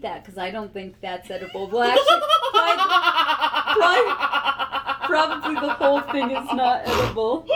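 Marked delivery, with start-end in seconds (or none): 1.7-7.5 words squeezed out through loud laughter; laughing hard. Real, not acted. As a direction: laugh helplessly while trying to finish. Laughing at the burnt birch curl.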